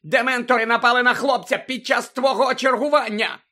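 high, loud accusation; shrieking місіс Фіг. A narrator's reading. Old woman blaming her lookout, shrieking. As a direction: hammer out the blame, screaming. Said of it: "верещала місіс Фіг".

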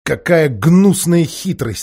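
slow, outraged exclamation; indignant. A narrator's reading. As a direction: spit out the disgust. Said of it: "сказал Тирион".

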